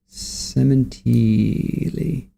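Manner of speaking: very slow, stretched "Sementili"; drawn-out name. Shown as a demonstration. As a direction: draw out every syllable of "Sementili". Host mulls the name Sementili.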